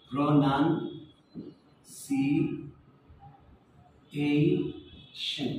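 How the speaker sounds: "প্রোনাউন্সিয়েশন" very slowly as pro-nun-si-ei-shon; correct, syllable by syllable. Shown as a demonstration. Teacher modelling correct pronunciation and accent, very slow syllables. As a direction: break "প্রোনাউন্সিয়েশন" into long separated syllables, nun in the middle. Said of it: "এটা হচ্ছে সঠিক উচ্চারণ এবং accent".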